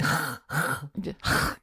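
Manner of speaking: soft throat-clearing German "ch"; rasped guttural "ch", quietly demonstrated; throaty CH sound. Shown as a demonstration. Co-host showing how to say CH, soft throat scrape. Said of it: "whenever you've got a C H".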